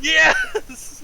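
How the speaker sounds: excited. Acted. Doug cheers on finding his cowboy hat, excited. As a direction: cheer at finally getting the hat.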